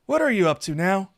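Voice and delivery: high, loud, wary question; suspicious. A narrator's reading. Saphir suspicious of his lover's surprise, wary. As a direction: question what your lover is plotting.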